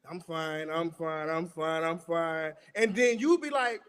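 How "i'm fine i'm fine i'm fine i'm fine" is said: lower-pitched repeated brush-off; imitated dismissive partner. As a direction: mimic a partner shutting down every question. Voices partner insisting all is fine.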